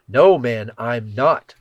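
loud, forceful denial; sharp retort. A narrator's reading. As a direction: snap back, defensive and loud. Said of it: "Peter retorted".

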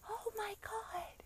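slow, high, whispered shriek; mock scream. Friend waking the sleeper once again, whispered mock screaming. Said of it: "this bitch screaming".

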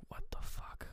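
barely audible, voiceless whisper; mouthed whisper. Retold or imitated. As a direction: mouth it to yourself with almost no sound. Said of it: "Wallace mouths one more time".

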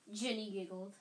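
ordinary delivery, nothing marked

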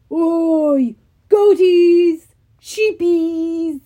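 loud monster roar. Acted. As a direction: bellow it like a giant calling sheep. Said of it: "the Cyclops roared".

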